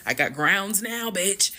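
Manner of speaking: high, loud gloating outburst; relieved triumph. Retold or imitated. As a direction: crow it like a sudden win. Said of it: "that's how I felt".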